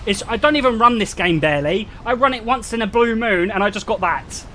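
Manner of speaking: usual speaking voice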